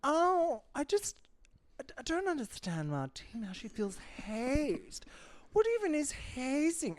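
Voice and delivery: high, airy posh lady voice; mock posh socialite. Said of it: "upped the ante on her posh voice".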